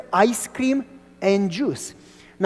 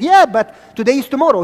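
protesting; 5 semitones higher, 10 dB louder than usual. Retold or imitated. Young self arguing with vendor.

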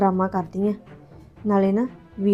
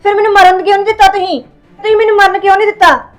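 higher and louder, wounded accusation; higher, louder aggrieved outcry; 5 semitones higher, 4 dB louder than usual. Acted. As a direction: demand why they stopped her. Staged suicide, complaining to brother.

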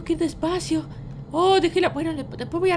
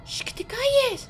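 high, slow, strained hush; urgent shushing; 8 semitones higher, slower than usual. Pretend. Child hushes Vita who screams thief, high urgent hiss. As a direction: shush them desperately without being heard. Hushing friend again.

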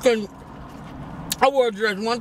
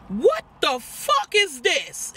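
high, drawn-out shocked mom; mama's outraged voice; 6 semitones higher, much slower than usual. Retold or imitated. Daughter imitating mama finding her stuff, outraged and drawn out. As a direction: stretch it out high, like an appalled mother. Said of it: "that's exactly how she sounded".

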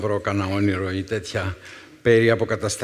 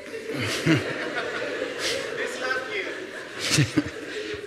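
laughs; 12 semitones higher, 7 dB quieter than usual. Real, not acted. Laughs mid retold defiant quote.

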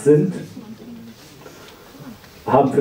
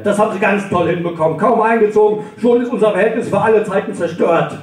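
sarcastic reproach; 6 semitones higher than usual. Acted. Sarcastic rant at neighbour.

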